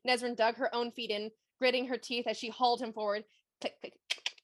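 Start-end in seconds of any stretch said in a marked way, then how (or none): 3.6-4.3 whispered clicking sounds; voiced like spider claws tapping on stone. Narrating creeping spiders, a whispered click click click.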